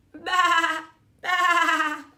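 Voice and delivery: loud, high baa like a sheep; sheep bleating. Acted. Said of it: "and they answered".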